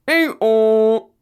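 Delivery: a loud mock donkey bray. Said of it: "replied like this".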